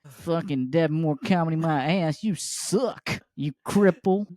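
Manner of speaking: put-on griping husband in a Southern accent. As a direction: call out to the wife, then rant in Southern drawl. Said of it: "I hope he's at home with his wife".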